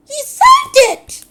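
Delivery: very high, very loud shriek. Acted. Lorraine shrieking at her husband. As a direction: screech it out. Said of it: "Lorraine shrieked".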